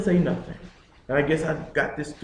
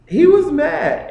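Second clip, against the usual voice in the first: "he was mad" pitched way up and loud; emphatic outburst; 14 semitones higher, 9 dB louder, slower than usual. Real, not acted. Son recalling his father's anger, loud, high, drawn-out emphasis on "mad".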